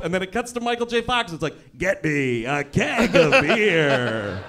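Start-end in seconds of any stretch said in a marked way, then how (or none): 1.8-4.4 very slow, gravelly movie line. Acted. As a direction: drag it out in a gravelly rumble. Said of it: "in the gravelly voice".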